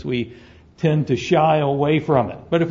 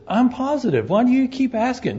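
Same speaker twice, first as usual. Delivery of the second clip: much higher, fed-up reply; exasperated snake; 8 semitones higher than usual. Acted. Second snake answers the nagging snake, much higher and fed up. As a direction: sound put-upon by the nagging.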